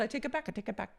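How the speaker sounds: soft, quick backpedal; mock meek retraction. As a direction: repeat it small and hushed, as an imitation. Acting out hurried retraction, soft.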